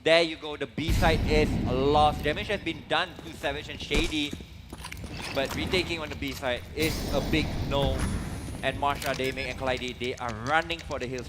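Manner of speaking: loud, high play-by-play call; esports caster. Acted. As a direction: call the push with rising hype. Live play-by-play of round.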